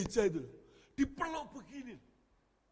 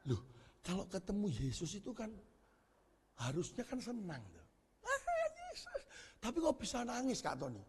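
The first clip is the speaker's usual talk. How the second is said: puzzled mother; 12 dB quieter than usual. Retold or imitated. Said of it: "mamanya tuh tanya saya".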